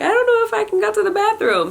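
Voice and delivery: high mock whimper; worried whine. Anxious patient to the nurse, high mock whine. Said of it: "And I'm like".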